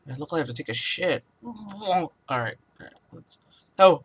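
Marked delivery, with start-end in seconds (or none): none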